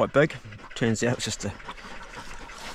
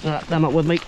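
ordinary delivery

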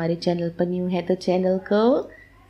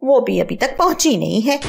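higher, sharp scolding mother-in-law; 6 semitones higher than usual. Acted. Mother-in-law scolds her daughter-in-law over late tea, higher and sharp. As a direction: snap at her for muttering alone. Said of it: "सास की आवाज आती है".

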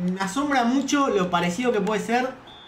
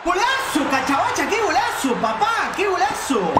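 breakneck goal commentary; 10 semitones higher than usual. Real, not acted. Gol propio celebrado a gritos. (grito de gol eufórico)

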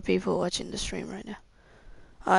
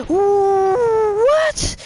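high, loud cry of disbelief; shocked cry; 11 semitones higher, 7 dB louder than usual. Real, not acted. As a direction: yelp it out in shock. Shocked at dying.

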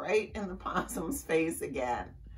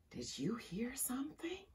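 soft, breath-only question; whisper; 6 semitones higher, 9 dB quieter than usual. A narrator's reading. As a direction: whisper it from inside a tent. Someone in tent hears noise.

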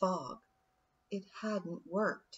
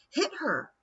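higher, sharp command; urgent shout; 9 semitones higher than usual. A narrator's reading. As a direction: bark the order. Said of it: "her third thought screamed".

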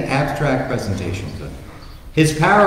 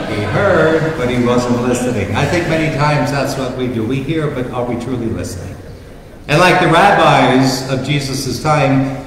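ordinary delivery

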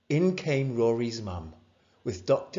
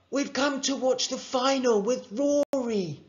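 much higher, eager chant; high-pitched young visitors; boys at the door; 12 semitones higher than usual. A narrator's reading. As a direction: announce yourselves to a friend's mum. Two boys announce themselves at door.

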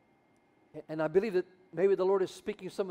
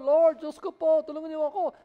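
high, loud wail for help; mock wailing prayer; 8 semitones higher, 5 dB louder than usual. Moses wailing to the Lord for rescue. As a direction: cry out helplessly to God.

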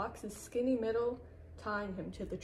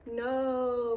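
loud, raised, drawn-out moan; dismayed moan; 4 semitones higher, 7 dB louder than usual. A narrator's reading. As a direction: wail it in disappointment. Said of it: "moaned the fox".

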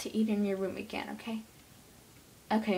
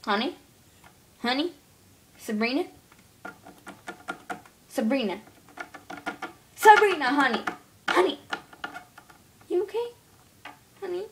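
higher, worried mom calling out; a mother checking on her girl, calling higher; mom calling her daughter; 5 semitones higher, 5 dB louder than usual. Pretend. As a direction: kid voicing a worried mom. Kid plays mom calling daughter.

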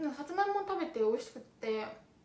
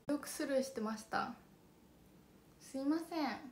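ordinary talk, nothing marked

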